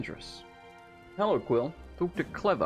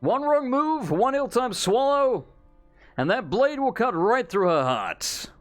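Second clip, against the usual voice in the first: booming showman hype; announcer voice; 4 semitones higher than usual. Acted. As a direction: sell the act to the crowd. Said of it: "use your best announcer voice".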